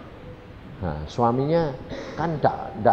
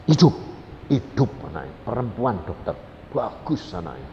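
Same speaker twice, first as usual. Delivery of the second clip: ordinary talk, nothing marked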